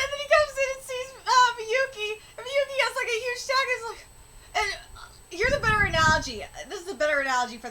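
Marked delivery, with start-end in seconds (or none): none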